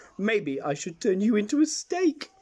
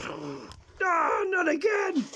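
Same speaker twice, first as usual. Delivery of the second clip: dismayed yelp; 11 semitones higher, much slower than usual. Acted. Pirate caught out again, dismayed cry. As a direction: wail at the bad luck.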